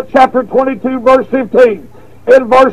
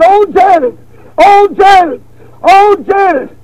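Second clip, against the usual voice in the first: hollering madman; 7 semitones higher than usual. Retold or imitated. Preacher voicing the second man in the cell, high hollered wail. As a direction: call out the name like a broken man.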